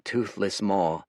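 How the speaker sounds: ordinary delivery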